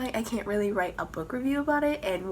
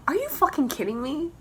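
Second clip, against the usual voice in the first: higher incredulous cry; can't believe it; exasperated disbelief at the ending; outraged disbelief; 7 semitones higher than usual. Real, not acted.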